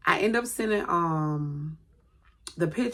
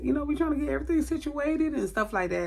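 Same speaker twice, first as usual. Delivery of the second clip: ordinary delivery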